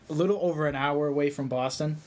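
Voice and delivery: ordinary delivery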